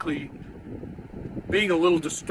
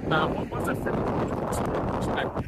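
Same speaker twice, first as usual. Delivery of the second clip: wind on mic; 5 dB quieter than usual. Real, not acted. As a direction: wind noise over the voice. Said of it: "Ooh, that is some windy".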